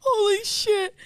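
high, loud tearful exclamation; gasping delight, close to crying; happy near-tears. Real, not acted. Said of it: "I'm actually gonna cry".

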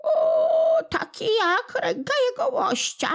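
very high, tearful whine of a tiny creature; a sore little imp wailing for pity; whimpering little goblin. Acted. Little kłobuk crying to old Smętek, very high and tearful.

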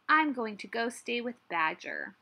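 ordinary delivery, nothing marked

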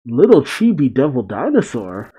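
ordinary delivery

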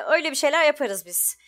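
ordinary talk, nothing marked

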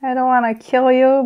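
ordinary delivery

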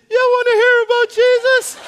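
strained high squeak with almost no voice; a squeaky, lost-voice whisper-shout; voice gone. Retold or imitated. Nervously asking the big guy on the bleachers, voice cracking high. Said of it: "And I lost my voice".

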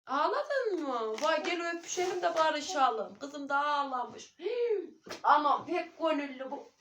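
high, loud, slow coo of sympathy; cooing to daughter. Real, not acted. Mother comforts crying daughter, loud high coo.

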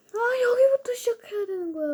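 high, fast, breathy whine; whiny cry. Real, not acted. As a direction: wail at the lost progress. Sent back to checkpoint.

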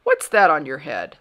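loud, pointed question; demanding. A narrator's reading. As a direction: pounce on him with the question. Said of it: "demanded the king".

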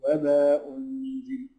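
high, slow chanted recitation; Quran recitation. Shown as a demonstration. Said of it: "Quran recitation demo".

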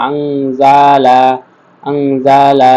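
আংজালা with a held nasal ং before জ; nasal ghunna. Shown as a demonstration. Teacher showing students the ghunna in আংজালা, humming it. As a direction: hum the ং in আংজালা, then open to জালা. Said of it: "এখানে গুণনা করতে হবে".

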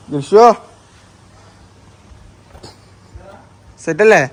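loud, high call across. Real, not acted. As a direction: call to someone far off. Calling out to Joshua.

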